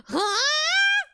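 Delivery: high-pitched rising squeal of surprise; sudden high, incredulous yelp; startled huh. Real, not acted. Startled by chat's question.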